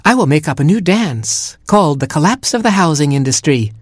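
high, bright-voiced dancer penguin; youngest penguin crying out. A narrator's reading. Youngest penguin proclaiming her new dance, high and theatrical. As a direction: announce the dance with flair. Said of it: "cried the youngest penguin".

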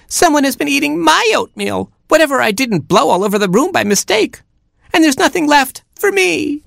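very high squeaky little chick; a tiny baby chick whining. Acted. Baby chick complaining to parents, very high squeak.

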